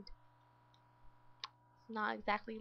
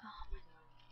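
breathy whispered oh my God; hushed dismay; 5 semitones lower, 10 dB quieter than usual. Real, not acted. Gamer whispering at her Sims in dismay. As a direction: whisper it in shock.

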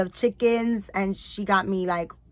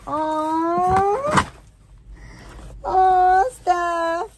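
delighted squeal; 9 semitones higher, 5 dB louder, much slower than usual. Real, not acted. Unboxing gift from friend.

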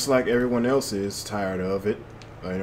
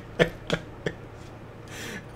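wheezing laugh; 8 semitones higher, 7 dB quieter than usual. Real, not acted. Laughing at own joke.

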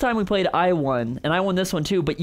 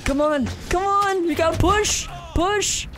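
high, urgent cheering; excited yelling; 12 semitones higher, slower than usual. Real, not acted. Cheering during final fight.

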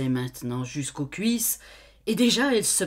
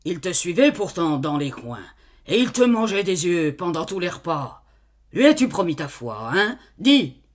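pressing interrogation; 6 dB louder than usual. Acted. Farmer interrupts, pressing her.

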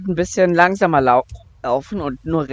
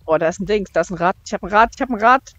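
ordinary talk, nothing marked

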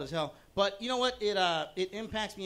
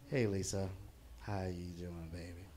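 put-on low, softening drunken come-on; boozy, low-pitched greeting, trailing softer; acted drunk; 8 semitones lower, 5 dB quieter, slower than usual. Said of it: "Remember, I'm drunk as hell".

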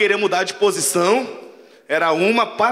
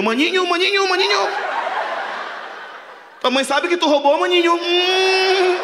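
mock TV host; 10 semitones higher than usual. Imitating TV crime show host.

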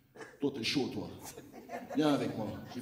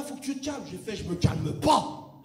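usual speaking voice

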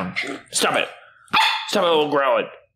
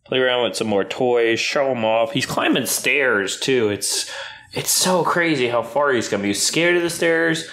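normal talking voice

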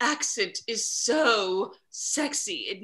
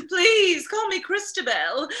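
gushing; 8 semitones higher, 4 dB louder than usual. Acted. Flirting loudly at guest.

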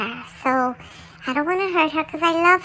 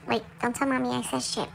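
ordinary talk

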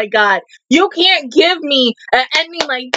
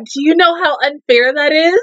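ordinary delivery

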